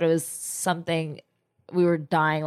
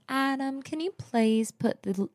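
put-on sweet Southern accent. Mimicking her co-host asking Adam for sweet tea, Southern accent. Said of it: "in your sweet Southern accent".

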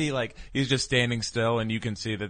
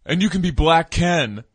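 much higher, louder hammed-up jab; mock punchline; 9 semitones higher, 9 dB louder than usual. Mocking the trailer, a much higher, louder jab. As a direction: belt the tag line for the laugh.

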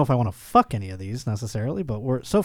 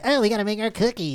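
higher, quick little elf voice; Keebler elf voice; 8 semitones higher, faster than usual. Retold or imitated. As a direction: chirp it out like a busy cookie elf. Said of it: "old man voice or a kid voice".

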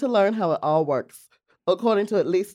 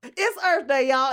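excited; 8 semitones higher, slower than usual. Real, not acted. Excited intro announcement.

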